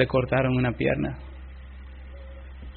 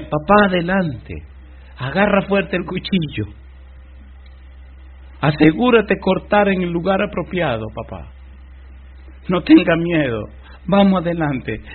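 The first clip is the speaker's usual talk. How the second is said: urging on; 6 semitones higher than usual. Acted. Isaac urges his father on.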